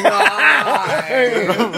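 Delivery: mock Australian "no". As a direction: mimic an Australian no, lingering on every sound. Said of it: "see if all the syllables are there".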